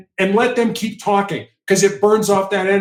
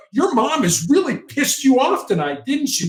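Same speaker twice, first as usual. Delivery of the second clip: higher, knowing empathic tone; empathic mirroring; 8 semitones higher than usual. Retold or imitated. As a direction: show you get it, sympathetic. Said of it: "you had to mirror what they were saying".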